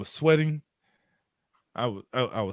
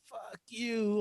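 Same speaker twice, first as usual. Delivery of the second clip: bitter retort; 10 semitones higher, 6 dB quieter than usual. Retold or imitated. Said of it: "I'm like".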